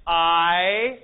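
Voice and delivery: I drawn out, as in "aisle"; pronunciation of alpha iota. Shown as a demonstration. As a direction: stretch I slowly into one long glide. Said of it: "in the word aisle".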